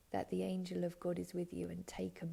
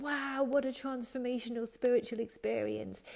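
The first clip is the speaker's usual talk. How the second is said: mock wow; 5 semitones higher, 4 dB louder than usual. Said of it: "it's not like".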